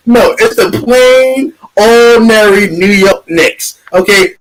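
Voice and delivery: ordinary delivery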